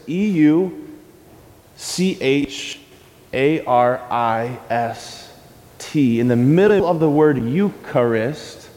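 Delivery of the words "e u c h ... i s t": letter by letter, slowly; slow spelling. Shown as a demonstration. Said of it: "in the middle of that word".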